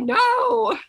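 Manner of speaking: high, drawn-out mock-appalled no; appalled refusal. Nurse retelling her answer to grandma's excuse, appalled. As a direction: protest in high-pitched disbelief. Retold refusal to elderly patient.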